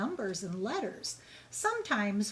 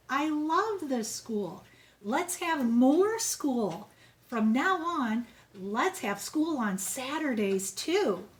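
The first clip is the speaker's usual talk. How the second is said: slow, swelling, louder enthusiasm; gushing principal; 3 semitones higher, 4 dB louder, slower than usual. Acted. Mister Keene announcing Saturday school to everyone, slow and gushing. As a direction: relish every word of his big idea. Principal grows carried away.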